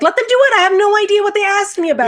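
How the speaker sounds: high-pitched, loud, quick outburst; exasperated protest. Retold or imitated. Contractor's client answering her partner's blame, exasperated. Acted exasperated comeback to Justin.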